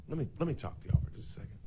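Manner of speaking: quick whispered aside to listeners; hushed aside. Real, not acted. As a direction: lean in and whisper fast.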